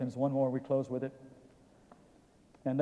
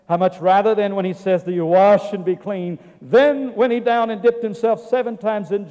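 loud, raised-pitch pulpit preaching; 4 semitones higher, 9 dB louder than usual. Acted. Preacher proclaiming grace and faith to the congregation, forceful. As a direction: drive the scripture home with force.

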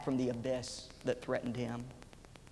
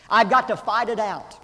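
determined; 7 semitones higher, 11 dB louder than usual. Retold or imitated. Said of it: "But Adam Fremont refused".

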